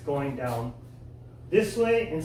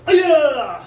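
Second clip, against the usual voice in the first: very loud piercing high yell; kiai shout; 11 semitones higher, 14 dB louder than usual. Shown as a demonstration. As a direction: explode the yell on the break. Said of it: "Now break it".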